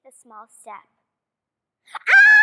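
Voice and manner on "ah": loud high scream; scream of fright. Retold or imitated. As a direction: shriek as you lose control. Falling down slope.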